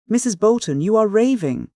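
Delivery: quick urgent rebuke; hasty. A narrator's reading. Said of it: "said Hope hastily".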